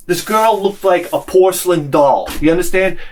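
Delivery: ordinary talk, nothing marked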